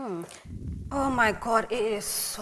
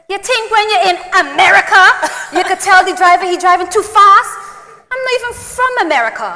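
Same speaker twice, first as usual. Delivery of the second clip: louder mocking Grenadian accent; imitating local passenger; 6 semitones higher, 8 dB louder than usual. Retelling the bus passenger's scolding, in mock Grenadian accent. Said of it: "and scrubbed her face and said".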